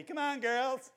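high-pitched mock aerobics coach; TV fitness guy. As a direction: cheer like a TV workout host. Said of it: "reminds me of a guy who used to be on T V".

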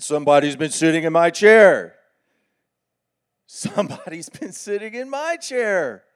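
loud booming papa bear; big bear father growing indignant. Acted. Papa bear voiced in story.

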